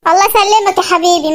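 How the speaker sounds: normal talking voice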